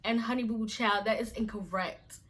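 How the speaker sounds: ordinary talk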